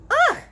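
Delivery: very high, loud spluttered ugh; disgusted sputter. A narrator's reading. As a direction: spit out a shriek of disgust. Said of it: "she sputtered".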